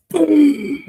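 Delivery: high, loud mock explosion noise; imitated bang of a metal bin; imitated boom. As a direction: blast it out like a mortar going off. Bin struck like a mortar.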